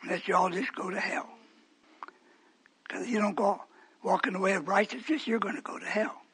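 soft, whispered warning of hell; hushed warning. Real, not acted. Preacher warning the church in a whisper. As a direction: lean in and whisper the threat.

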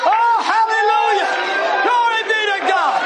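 high, shouted hallelujah; exultant praise. Real, not acted. Preacher praising God before the congregation, exultant praise. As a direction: cry out praise at a high pitch.

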